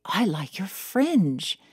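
quoting a Brit. Retold or imitated. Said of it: "someone would say to me".

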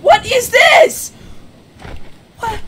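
high, loud and slow; outraged disbelief. Real, not acted. Streamer staring at a bad result, slow outraged cry. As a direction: stretch it out in total disbelief.

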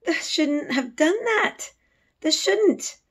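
high, slow, scared scolding; frightened pleading. Acted. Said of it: "she cried".

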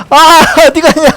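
high, loud laughing disbelief. Real, not acted. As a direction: laugh it out in surprise. Laughs at chase.

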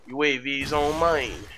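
put-on slow English with a Russian accent. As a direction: read it out like a Russian announcer. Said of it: "Parece russo falando em inglês".

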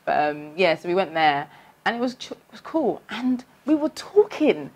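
usual speaking voice

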